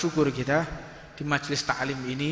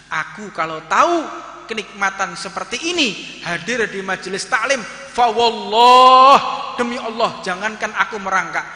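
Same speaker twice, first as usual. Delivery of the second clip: loud, high fervent oath swelling on fa wallah; passionate vow; 6 semitones higher, 12 dB louder than usual. Acted. Repentant companion vowing devotion to the Prophet, loud fervent oath. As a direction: swear it with everything you have.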